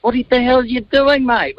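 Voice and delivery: high-pitched mock protest; imitated high-voiced complaint; retold indignant mate. Retells mate's complaint on coming out.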